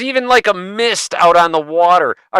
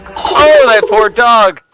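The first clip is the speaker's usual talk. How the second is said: loud sympathetic shock; 4 semitones higher, 6 dB louder, much slower than usual. Real, not acted. Reacting to dog and cat clip.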